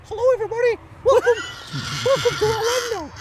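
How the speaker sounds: very high, loud squeaky cartoon mouse; Mickey Mouse voice. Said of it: "Mickey Mouse impressions".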